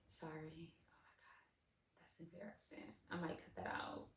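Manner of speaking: barely audible aside; real hushed, self-conscious apology; embarrassed whisper. Real, not acted. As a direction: whisper it to yourself, mortified. Embarrassed aside after going off topic.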